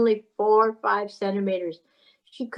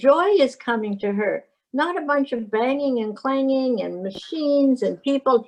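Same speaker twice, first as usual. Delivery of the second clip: ordinary delivery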